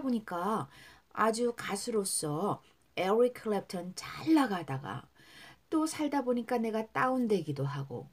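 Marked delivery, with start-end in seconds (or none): none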